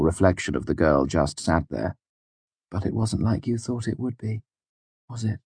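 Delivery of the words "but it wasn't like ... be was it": soft, higher, tender voice; gentle. A narrator's reading. Denner to a girl near tears, gently. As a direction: ease gently into the question. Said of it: "more gently this time".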